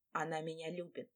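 ordinary delivery

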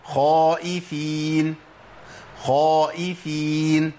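خائفين with a full emphatic خ, slow; correct heavy kha. Shown as a demonstration. Teacher models the correct form, heavy kha. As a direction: model خائفين with rounded lips on the خ. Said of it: "يبقى أقول إيه؟".

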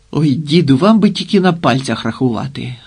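lightly exasperated brush-off; mildly impatient, swatting the remark away; dismissive retort. A narrator's reading. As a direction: wave the old man off. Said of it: "відмахнувся Вервезуб".

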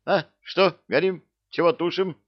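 high, loud, flustered questions; alarmed cry. A narrator's reading. Wood goblin doused with water from bushes, alarmed cry. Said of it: "всполошённо закричал кто-то из кустов".

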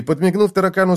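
usual speaking voice

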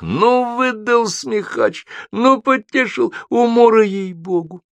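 high, stretched-out spectator cries; amused crowd. A narrator's reading. Onlookers in windows to the joker, amused hooting. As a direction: hoot in delight, pitch way up.

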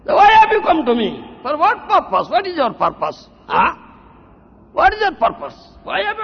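loud, high, indignant questioning; scolding challenge. Acted. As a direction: demand an answer from a wayward disciple. Guru challenges the complaining disciple.

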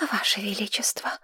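hushed, fawning whisper; obsequious whisper. A narrator's reading. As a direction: whisper it like a servile courtier. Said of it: "Подобострастный шёпот". (подобострастный шёпот)

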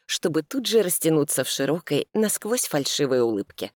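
ordinary delivery

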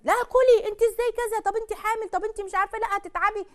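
husband snapping at his waiting wife, high and exasperated; irritated husband. Retold or imitated. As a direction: scold her like a nervous husband who lost patience. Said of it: "يجي هو يتنرفز".

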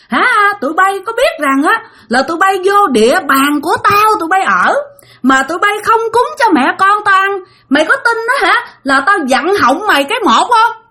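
high-pitched angry tirade; cursing ghost. Retold or imitated. Ghost cursing those living on its turf, high and furious. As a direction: berate them as an enraged spirit. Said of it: "Cổ chửi".

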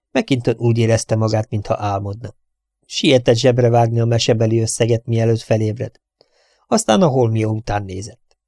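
ordinary delivery, nothing marked

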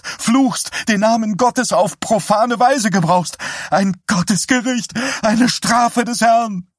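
high, loud, desperate pleading; panicked zealous wife voice; frantic pleading. Acted.

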